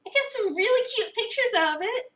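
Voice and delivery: high, loud, smug tease; teasing. Acted. Finley teases Avery about the cute pictures, high and smug. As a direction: dangle the photos over them.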